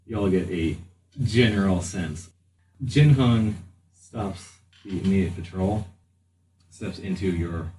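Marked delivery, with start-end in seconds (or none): none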